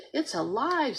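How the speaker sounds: a startled high shout; yelling. A narrator's reading. Boy yells at the jumping frog in alarm. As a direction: Stink yells, shocked by the frog. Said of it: "Stink yelled".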